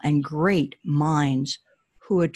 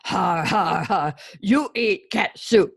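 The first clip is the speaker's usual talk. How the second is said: booming ogre laugh; 3 semitones higher, slower than usual. Acted. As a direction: gloat like a mocking ogre. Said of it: "announced the ogre".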